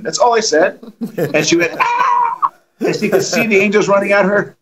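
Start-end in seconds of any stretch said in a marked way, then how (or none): 1.8-2.5 piercing high scream; high shriek. Retold or imitated. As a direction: shriek as if seeing angels charge. Possessed woman screams at angels.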